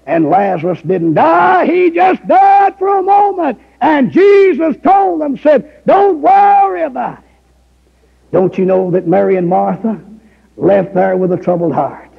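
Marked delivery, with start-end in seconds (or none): 3.8-7.2 preaching at full force. Acted. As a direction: preach it out, voice ringing high.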